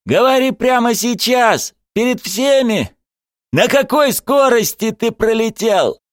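high, urgent yelled questioning; angry demand. A narrator's reading. Coach interrogates Zagir before everyone, shouting angrily. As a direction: press for an answer, voice pitched high.

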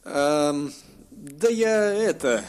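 mumbling. A narrator's reading. Dragon mumbling an excuse to the elf, hesitant. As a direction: stall awkwardly. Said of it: "пробормотал дракон".

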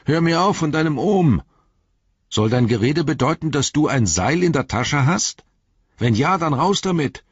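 high, exasperated call; amüsiert-ärgerliches Rufen. A narrator's reading. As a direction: call up impatiently, half amused. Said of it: "bei allem Ärger, auch lustig zu finden". (hoch und ungeduldig hinaufgerufen)

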